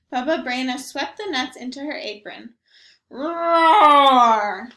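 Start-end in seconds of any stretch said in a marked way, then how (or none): 3.1-4.7 huge loud roar; loud bear roar. Acted. Bear roaring when the old lady takes the nuts. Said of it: "Old Bear roared".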